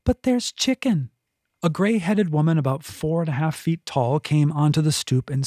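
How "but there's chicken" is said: a lightly high, pushy cat; cat pestering her person, high and eager. A narrator's reading. Nax replies.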